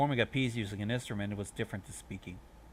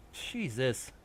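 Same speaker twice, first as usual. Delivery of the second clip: plain reading voice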